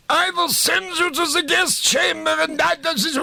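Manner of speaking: very high, shouted officer's rant; mock Gestapo officer shouting his lines; Nazi officer ranting. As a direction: bellow the gas-chamber threat like a hammy Nazi officer. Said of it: "And he was saying".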